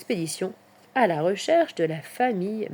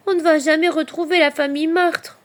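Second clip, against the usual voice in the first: high, louder little hedgehog lament; dismayed; 8 semitones higher, 6 dB louder than usual. A narrator's reading. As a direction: lose hope, voice pitched up. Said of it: "se désole Petit Hérisson". (désolation, voix aiguë)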